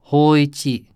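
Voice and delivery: a stern summons from the dark; grim call. A narrator's reading. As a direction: call his name grimly and firmly. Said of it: "grimly called the voice a second time".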